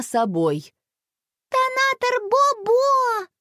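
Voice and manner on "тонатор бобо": put-on very high, drawn-out little-girl voice; baby sister's proud, high proclamation; toddler announcing. A narrator's reading. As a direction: squeal it out slowly like a tiny child. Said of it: "объявила малышка Алина".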